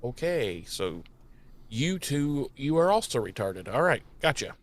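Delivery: muttered sarcastic aside; deadpan aside. Retold or imitated. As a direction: say it quietly and dryly to herself. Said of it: "she's just like".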